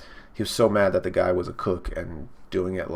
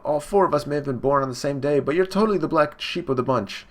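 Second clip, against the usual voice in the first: higher-pitched acted jeer; imitated sibling scorn, pitch raised; taunting brother; 6 semitones higher than usual. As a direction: put on a bullying brother's taunt. Brother taunts young Sanji.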